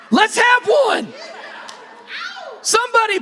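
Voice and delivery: playful high shout asking someone to plan the party; calling out. Real, not acted.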